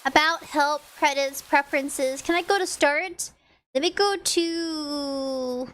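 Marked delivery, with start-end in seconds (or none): none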